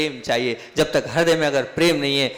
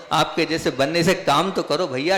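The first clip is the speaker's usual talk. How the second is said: ordinary talk, nothing marked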